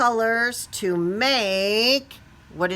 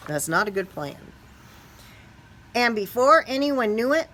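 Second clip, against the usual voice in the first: usual speaking voice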